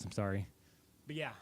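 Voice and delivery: usual speaking voice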